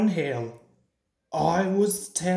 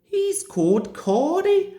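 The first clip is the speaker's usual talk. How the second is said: Donald speaking; 9 semitones higher than usual. A narrator's reading. Donald explaining.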